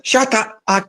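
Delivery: high, squawky cartoon duck voice; Donald Duck. Quoting a favorite video's ending, high duck voice. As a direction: quack it out high and garbled. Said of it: "it's in the Donald Duck voice".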